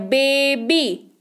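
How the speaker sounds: "बेबी" very slowly, split into बे and बी; two syllables. Shown as a demonstration. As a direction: stretch "बेबी" into two separate beats. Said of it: "दो बार टकरा रहे हैं".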